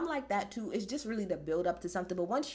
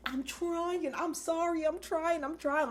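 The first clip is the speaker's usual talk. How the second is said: higher, apologetic, a little frantic; mock pleading producer; 6 semitones higher, slower than usual. Producer apologizing to Bruce that the match won't end, higher and pleading. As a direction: beg for patience through a headset. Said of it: "the producer's like".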